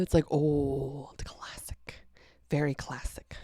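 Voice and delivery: unvoiced searching whisper. Real, not acted. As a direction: whisper it to yourself.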